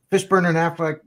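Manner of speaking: usual speaking voice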